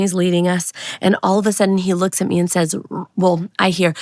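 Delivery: ordinary talk, nothing marked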